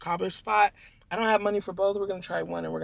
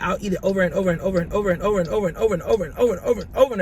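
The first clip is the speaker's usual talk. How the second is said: very fast rattled-off list; rapid repetition; 6 dB louder, much faster than usual. Pretend. Rattling off how often she eats a favorite food, very fast. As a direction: race through the overs breathlessly.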